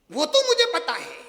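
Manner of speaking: loud smug brush-off; know-it-all bride. Retold or imitated. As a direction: brush her off, loud and sure. Repeated know-it-all line. (ज़ोर से, घमंड भरा)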